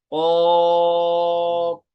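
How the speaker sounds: कॉफ held very long on one steady pitch; three-alif elongation. Shown as a demonstration. Teacher showing students the long कॉफ, slow and drawn. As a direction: draw कॉफ out over three counts. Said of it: "कॉफ को तीन अलिफ तक खींच कर पढ़ेंगे".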